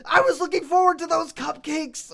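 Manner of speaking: high, loud aggrieved whine; mad. Retold or imitated. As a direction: wail it like cheated, outraged friends. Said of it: "they were mad".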